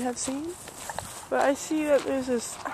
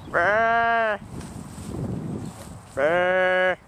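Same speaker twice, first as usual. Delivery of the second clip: barking; 3 semitones lower than usual. Pretend. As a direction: bark like a dog with a trilled brr. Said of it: "I was barking".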